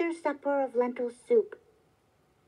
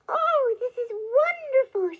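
gasping, high-pitched delight; very excited; 10 semitones higher, 5 dB louder than usual. A narrator's reading. Wife hears of three wishes, very excited and high-pitched. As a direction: exclaim it loudly and much higher, thrilled. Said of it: "She became very excited".

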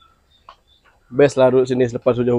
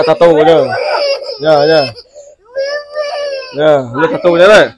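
higher, louder sing-song coaxing voice; coaxing a toddler; 6 semitones higher, 4 dB louder than usual. Acted. Parent coaxing a crying child to repeat words, high sing-song coaxing. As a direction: coax the little one along, voice lifted.

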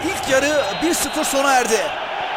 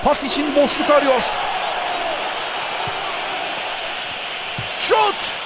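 live play-by-play. Real, not acted. Live match commentary.

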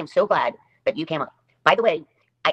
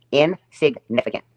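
very slow, syllables drawn apart on "Insignificant"; "Insignificant" broken slowly into its syllables; slow repeat; much slower than usual. Shown as a demonstration. Streamer repeats an insult to a rival, slowly and deliberately. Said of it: "I'll say it again".

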